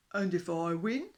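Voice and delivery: loud, slow, far-off beast voice; smug dinosaur. A narrator's reading. Dinosaur smugly asks the fish king what he wins. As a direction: ask it as if it's certain.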